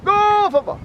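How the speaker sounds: shouted high cheer, stretched slow; booming stadium cheer. Acted. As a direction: chant it like a fan in the stands. Repeated cheer per territory.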